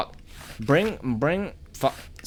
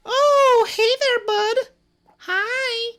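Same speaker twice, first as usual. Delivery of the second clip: very high, louder cutesy greeting voice; squeaky falsetto greeting; 6 dB louder, much slower than usual. Pretend. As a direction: coo at the little buddy in a tiny squeaky voice. Greeting a follower creature.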